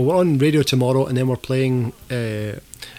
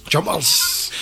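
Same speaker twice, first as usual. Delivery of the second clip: put-on voice; 6 semitones higher than usual. Echoing co-host's word in put-on voice.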